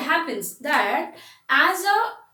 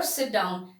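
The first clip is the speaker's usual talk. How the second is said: low-pitched, softer bare order; plain command; 6 semitones lower, 5 dB quieter than usual. Shown as a demonstration. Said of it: "has changed the meaning".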